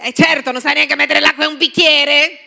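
loud, sarcastic jab; mocking put-down. Coworker mocking someone who cannot pour water, loud and sarcastic. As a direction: belittle him with scorn.

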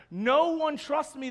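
ordinary talk, nothing marked

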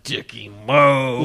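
slow, stretched-out mock wail; imitating film producer. Host mimicking the producer at the other microphone, slow mock drawl. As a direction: drag out each syllable, hammed up. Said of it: "is like".

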